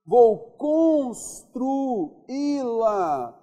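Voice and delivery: Instruí-la drawn out extremely slowly, leaning on í; very slow classroom drill on the í; stretched stress. Shown as a demonstration. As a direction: hold the í of Instruí-la as long as you can. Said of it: "Nós temos vogal, vogal".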